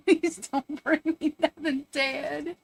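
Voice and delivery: hammed-up disgusted scolding; mock scolding. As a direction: scold the dogs half-laughing. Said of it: "I was like".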